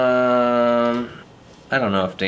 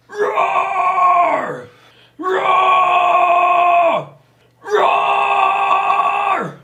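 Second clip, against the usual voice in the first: screamed roar, much higher and louder; monster roar; 7 dB louder, much slower than usual. Acted. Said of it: "That's not scary".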